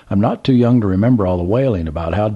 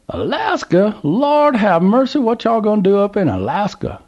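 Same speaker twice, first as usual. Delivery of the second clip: put-on old Southern gentleman; 13 semitones higher, 3 dB louder than usual. A narrator's reading. Old man calls to trucker, high Southern voice. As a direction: play the curious old man at the gas pump.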